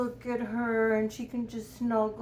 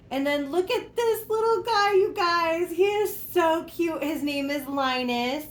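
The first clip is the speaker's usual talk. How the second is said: high, sugary show-and-tell voice; cutesy high voice; 8 semitones higher than usual. Real, not acted. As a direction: coo over the doll in a high pitch. Showing off a cute doll.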